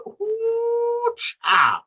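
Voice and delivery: high drawn-out "ooh" falling to a hit; imitated slam noise. Fan acts out a wrestler's slam, high whoop then hit.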